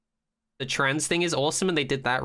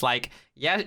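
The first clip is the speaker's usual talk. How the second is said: normal talking voice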